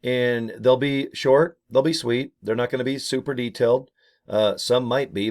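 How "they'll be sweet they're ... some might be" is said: usual speaking voice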